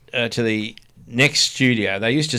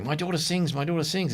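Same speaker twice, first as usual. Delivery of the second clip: pushy mum; 6 semitones higher, 8 dB quieter than usual. Retold or imitated. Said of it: "she was going".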